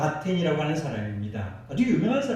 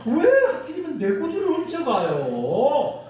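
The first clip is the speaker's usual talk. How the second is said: mock whiny complaint pitched higher; grumbling prayer, pitched up; complaining to God; 8 semitones higher than usual. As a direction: whine at God like a wronged man. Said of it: "하나님 앞에 그러는 거예요".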